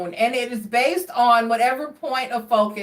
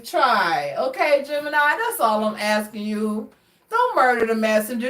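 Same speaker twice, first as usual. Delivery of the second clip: ordinary talk, nothing marked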